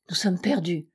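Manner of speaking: ordinary delivery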